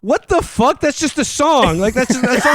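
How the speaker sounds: can't believe it's a song; exasperated. Retold or imitated. Reacting to the movie line, high and exasperated. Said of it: "I was just like".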